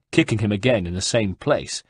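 usual speaking voice

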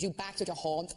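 "back" in a Belfast accent, a short flat a where "box" would be; a light Belfast accent on "back". Shown as a demonstration. A comic demonstrating to the panel the Belfast swap of O for A. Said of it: "if you wanna do a Belfast accent".

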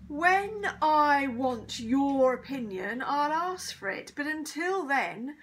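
loud, slow, stern teacher's shout. A narrator's reading. Teacher scolds interrupting pupil, shouting. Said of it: "shouted Mister Irons".

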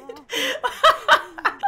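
loud, high burst of laughing; high delighted laughter. Real, not acted. Player seeing the ejection, laughing hard.

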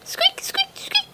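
tiny high squeaks; high soft mouse squeaks. Acted. Mouse scampers in.

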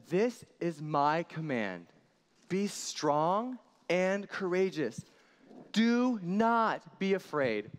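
loud, slow congregational reading; slow loud recitation. Shown as a demonstration. Pastor leads kids reading Joshua aloud, slow and loud. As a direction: read out each word together, slowly. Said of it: "read it all out loud together".

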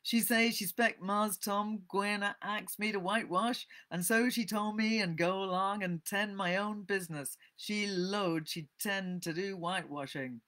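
put-on reluctant character in old Southern dialect accent; Jim. A narrator's reading. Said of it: "Sorry about the accent".

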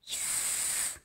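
long unvoiced hiss; sneaky snake hiss. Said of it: "just like snake".

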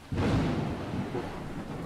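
ordinary talk, nothing marked

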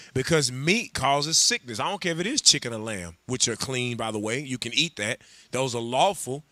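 normal talking voice

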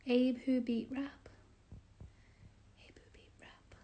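whispered, fading sounding-out; hushed whisper, questioning at the end; puzzled whisper. Real, not acted. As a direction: whisper it to yourself, working it out. Puzzling it out under breath.

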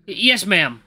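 a touch of intimidated obedience; nervous compliance. Acted. Eli answers the intimidating maid, nervously stammering. Cowed by the maid's heavy presence.

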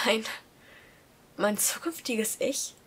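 stunned by the letter, soft and slow; shocked gasp. A narrator's reading. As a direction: breathe it out in shock. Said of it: "Ich keuchte erschrocken auf".

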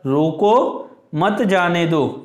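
रोको drawn out, pause, then मत जाने दो; slow, with the break right after रोको; stress on रोको. Shown as a demonstration. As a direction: hit रोको and stop at the comma. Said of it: "रोको को हम जोर से बोलेंगे".

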